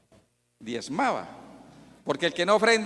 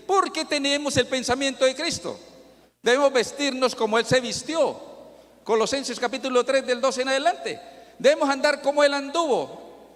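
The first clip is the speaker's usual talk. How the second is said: raised, higher ringing sermon voice; fervent preaching; 7 semitones higher than usual. Acted. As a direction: preach it out with rising fervor. Preaching at full force. (predicación enérgica, en voz más alta)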